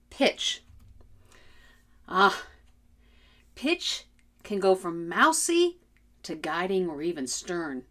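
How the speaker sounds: usual speaking voice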